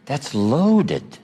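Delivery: normal talking voice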